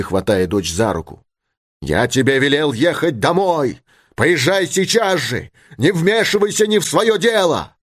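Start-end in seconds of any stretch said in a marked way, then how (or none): 1.8-7.8 high, rising scolding; angry father. A narrator's reading. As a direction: order the daughter off, pitch climbing. Father ordering daughter home. (сердитый отцовский приказ высоким голосом)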